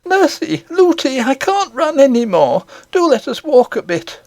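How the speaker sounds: put-on high child's voice begging to stop; a worn-out little girl asking to walk; tired child pleading. A narrator's reading.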